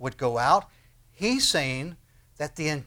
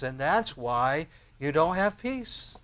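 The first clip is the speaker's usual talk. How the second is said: ordinary talk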